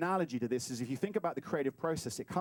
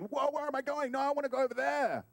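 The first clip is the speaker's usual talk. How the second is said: high-pitched comic panic; mock alarm; 9 semitones higher than usual. Rocket suddenly sent off mid-course, mock panicked protest.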